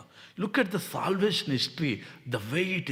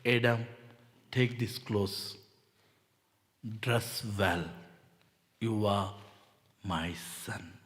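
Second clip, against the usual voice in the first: lower, softer, slow fatherly words; tender God voice; 6 semitones lower, 5 dB quieter, slower than usual. Acted. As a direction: speak to Adam like a loving father. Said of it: "gives to Adam".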